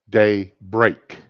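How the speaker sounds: "day break" as two slow, separate words; careful correction. Retold or imitated. Doctor correcting the misheard word, "day break" carefully split. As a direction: clear pause between "day" and "break".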